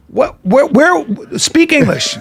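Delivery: stammer, then slowly stretch it out in mock exasperation; acted-out fatherly impatience; imitated exasperated father. Acts father baffled by his son.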